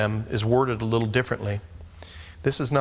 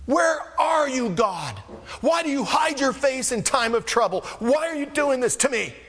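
high, raised pleading cry to God; desperate cry; 12 semitones higher than usual. Acted. Frightened disciples calling out to God in the storm, high pleading cry. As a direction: cry out like men sinking in a storm.

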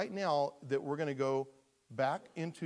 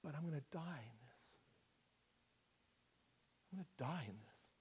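much softer, deflated; quiet despair; 10 dB quieter than usual. Acted. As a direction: let it sink, nearly giving up. Drowning person's quiet despair.

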